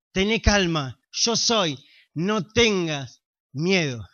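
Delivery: más suave, más grave y lento, tranquilizador; susúrralo casi como consuelo a quien tiene miedo; calma serena. Retold or imitated. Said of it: "pero Jesús te dice". (Jesús consuela al que no ve la salida, suave y pausado)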